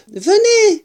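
high, loud summons; joyful calling out; excited shout. A narrator's reading. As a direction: call them over at full voice. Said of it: "cria-t-il".

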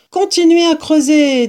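high, loud urging to keep going; urging call. Acted. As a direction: call out encouragement to the diggers. Fox urges digging children on.

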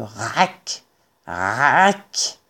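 low rasped scraping noise; imitated shovel scraping. A narrator's reading. Narrator imitates a shovel digging above the foxes, low scraping noise. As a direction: sound out each scrape, low and drawn out.

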